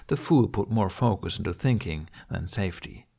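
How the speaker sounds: usual speaking voice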